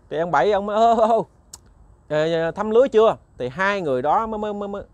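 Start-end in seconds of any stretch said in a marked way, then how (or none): 0.8-3.2 put-on old man calling across the water, louder and higher. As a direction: call out to the passing boat. Old man calls to boat. (giọng gọi lớn qua sông)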